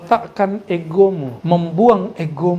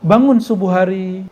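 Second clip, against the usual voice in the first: normal talking voice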